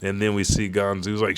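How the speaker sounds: ordinary talk, nothing marked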